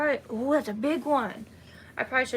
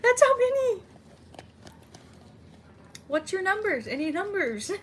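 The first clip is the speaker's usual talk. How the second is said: excited; 8 semitones higher, 5 dB louder than usual. Real, not acted. Excited about the count.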